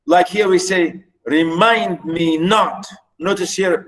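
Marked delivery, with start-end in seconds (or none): 1.2-2.9 slow remind me not, stressing MIND and NOT; low-then-up iambic stress. Shown as a demonstration. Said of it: "start low and go up".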